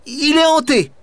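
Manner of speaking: little boy insisting, voiced high and pressing. A narrator's reading. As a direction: plead with the baron to spare the tower. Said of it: "et insiste".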